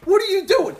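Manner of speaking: high, loud frantic guard yell; frantic security guard shouting; mimicking security guard. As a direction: bark it like a panicked guard stopping a car. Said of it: "He's like".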